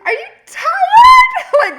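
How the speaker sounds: hammed-up squeal, very high and loud; exaggerated imitation. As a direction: blurt it slowly in a shrieking mock of her, laughing. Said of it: "Like this".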